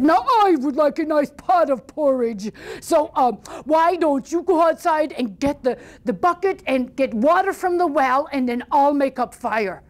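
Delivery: bossy troll. Acted. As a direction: order him about like a big lazy troll. Troll gives orders.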